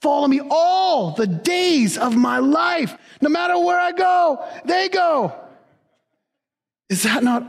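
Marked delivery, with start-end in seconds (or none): 0.0-5.3 exultant preaching shout; excited shout. Real, not acted. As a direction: call it out with joy. Acting out verse with children.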